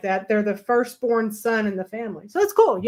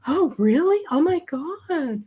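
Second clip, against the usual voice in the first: mock amazement; 6 semitones higher, slower than usual.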